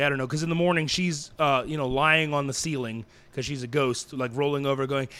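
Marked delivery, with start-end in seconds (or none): none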